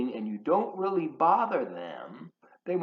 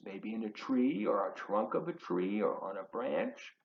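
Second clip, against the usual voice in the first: whisper; 4 semitones lower, 8 dB quieter than usual. Acted. Reading a book, hushed aside.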